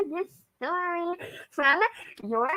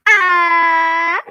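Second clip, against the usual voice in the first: excited ah; 2 semitones higher, 10 dB louder than usual. Acted. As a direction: belt out a joyful ah. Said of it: "So it's the first time I'm saying it now".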